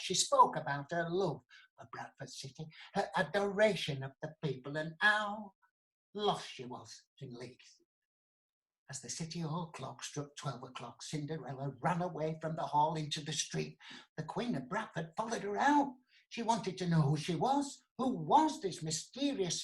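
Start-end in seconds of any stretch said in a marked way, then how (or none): none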